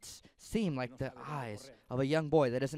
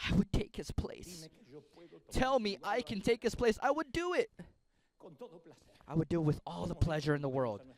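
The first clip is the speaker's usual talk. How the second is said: pleading parent. Acted. Parent offering to take child's place.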